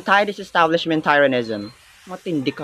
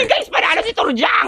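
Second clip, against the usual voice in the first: excited cheer. Acted. Friend cheers the win.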